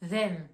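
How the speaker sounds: "them" with a voiced th; English them. Shown as a demonstration. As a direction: say "them" with a buzzing th at the teeth. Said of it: "qui signifie eux ou elles".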